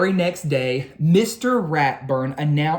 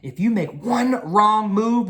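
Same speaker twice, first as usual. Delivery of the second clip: higher, louder spooky warning; 6 semitones higher, 4 dB louder than usual. A narrator's reading. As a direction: warn them like a ghost story. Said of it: "warned Binky Barnes".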